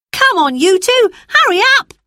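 put-on high, loud boy hurrying the others along; calling out. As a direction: shout ahead to the lagging sisters. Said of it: "said Peter".

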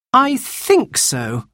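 high, slow, hesitant answer; drawn-out, thoughtful child's reply; slowly. A narrator's reading. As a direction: weigh it up as you answer. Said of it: "said Anthea slowly".

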